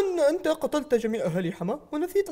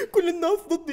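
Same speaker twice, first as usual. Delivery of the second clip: normal talking voice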